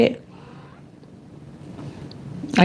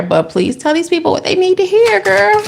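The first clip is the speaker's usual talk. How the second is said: much higher, playful plea to Spirit; high pleading call; 12 semitones higher than usual. Acted. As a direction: beg the spirits sweetly, up high.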